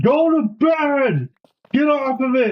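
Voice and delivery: high, drawn-out mock yell; imitated dad's yell. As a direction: order a kid to bed in a stretched, high-pitched holler. Imitates dad catching him up late.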